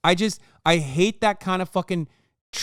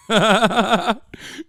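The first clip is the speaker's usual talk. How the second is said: loud laugh; 7 semitones higher, 7 dB louder than usual. Real, not acted. Streamer laughing loudly at the clip, loud laugh. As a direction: burst of high loud laughter.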